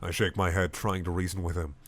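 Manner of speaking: plain reading voice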